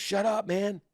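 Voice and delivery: high-pitched, exasperated brush-off; mock irritated retort. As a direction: cut the peacemaker off, playfully cross. Other brain side snaps back.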